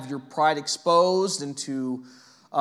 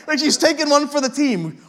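laughing; 10 semitones higher, 6 dB louder than usual. Real, not acted.

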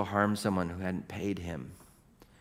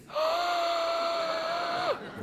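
long, high-pitched gasp; acted shocked gasp. Preacher as Saul learning who speaks, long shocked gasp. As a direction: suck in air in horror.

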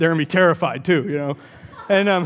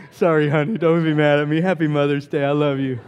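ordinary talk, nothing marked

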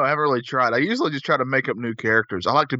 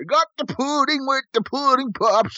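a much higher, slow, stretched pitchman voice; Cosby pudding voice; 12 semitones higher, slower than usual. Retold or imitated. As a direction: drag out every word like a goofy ad. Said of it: "it was shelled like this".

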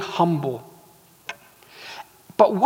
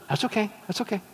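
hushed dismissal; 3 semitones higher, 12 dB quieter, much faster than usual. Acted. Wife tells genie she accepts the catch, hushed aside. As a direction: play the wife quietly brushing it off. Wife brushes off husband's double share.

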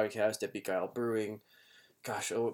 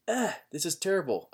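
groaning; 8 semitones higher, 3 dB louder than usual. Retold or imitated. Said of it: "I'm just like".

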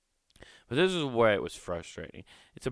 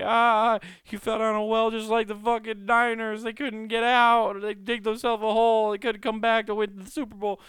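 much higher, louder taunting voice; mocking family; 13 semitones higher, 7 dB louder than usual. Family mocking him stuck in the well, high taunting. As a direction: jeer at him in a high cartoon voice. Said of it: "they're just like".

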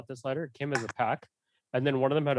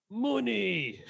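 weak cockney money; 8 semitones higher than usual. Pretend. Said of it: "You got nothing".

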